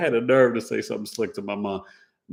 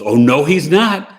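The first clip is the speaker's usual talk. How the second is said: loud, higher, drawn-out contradiction; emphatic mock retort; 6 semitones higher, 11 dB louder than usual. Mother contradicting her child about the grandson, loud indignant retort. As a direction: imitate a mother flatly refusing, loud and slow.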